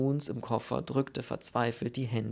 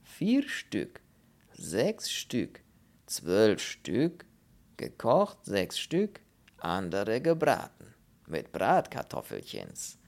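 drawn-out foreign voice with swooping pitch; rapturous Polish character, phrase by phrase; savouring Pole; 3 dB louder, slower than usual. Acted. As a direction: list the dream meal, relishing each item. Pole lists a dream meal.

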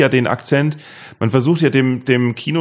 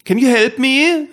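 high, slow put-on voice, English; much higher, drawn-out silly plea in English; prank asking tourist; 14 semitones higher, 3 dB louder, slower than usual. Schoolboy pranking an English passer-by, silly high plea. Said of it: "zu Leuten gegangen sind und gesagt haben".

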